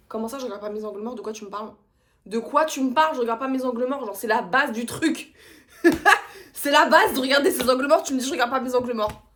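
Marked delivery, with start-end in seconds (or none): 2.2-5.3 loud, indignant outburst; losing it. Retold or imitated. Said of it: "mais j'ai pété un câble". 5.8-6.5 loud, high burst of laughter. Real, not acted. Laughs at her own outburst. 6.5-9.2 very loud, higher indignant rant; losing it. Retold or imitated. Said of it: "mais j'ai pété un câble".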